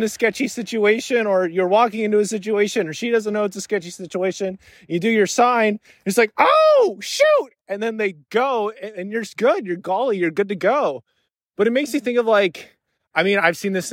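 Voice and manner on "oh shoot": very high, startled outburst; mock startled realization, pitched way up; acted alarm. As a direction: yelp it as if suddenly tipped off. Acts alarm on seeing secret sign.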